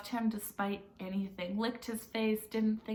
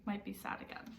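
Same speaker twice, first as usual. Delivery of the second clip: whispered; 10 dB quieter than usual. Real, not acted. Aside after sad chapter.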